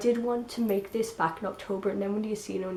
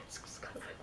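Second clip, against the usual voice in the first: whispering; 16 dB quieter than usual. Real, not acted. Quiet aside after a laugh.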